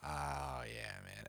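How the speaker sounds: barely there sigh of relief; hushed relief. Retold or imitated. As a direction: breathe it so he doesn't wake. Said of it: "I'm like".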